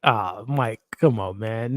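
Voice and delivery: usual speaking voice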